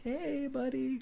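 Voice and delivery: sarcastic singsong hello to the maniac; fake friendly greeting. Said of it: "and they're like".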